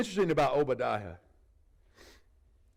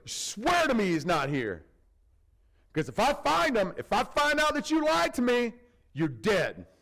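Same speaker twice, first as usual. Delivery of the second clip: menacing Ahab; 7 semitones higher, 4 dB louder than usual. Retold or imitated. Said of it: "Ahab's a little excited".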